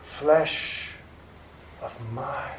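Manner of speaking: hushed, drawn-out hypnotic whisper, fading in and out; ghostly whispered vampire incantation; eerie vampire whisper. Acted. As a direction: breathe each word slowly like a spell over the sleeper. Vampire hypnotising sleeping Mina.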